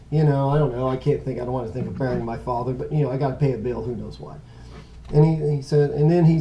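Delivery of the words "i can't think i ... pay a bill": ordinary talk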